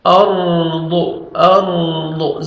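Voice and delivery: "ارض" with full r and thick final daad; heavy raa. Shown as a demonstration. Teacher showing the rule, "ارض" with heavy raa and daad. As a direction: hold a thick, steady r in "ارض". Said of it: "را کو پر کریں گے".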